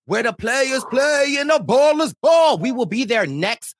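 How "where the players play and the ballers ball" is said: loud, high hype-man call; hype shout. Acted. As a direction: pump up the crowd. Hyping the tour city.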